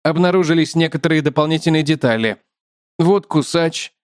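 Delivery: usual speaking voice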